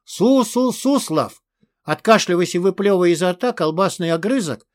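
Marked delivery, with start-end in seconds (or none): 0.0-1.4 slow, high, stuttering croak; choked wheeze. A narrator's reading. Hostage spitting out sausage, croaking. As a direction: splutter the name out. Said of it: "прохрипел недавний заложник".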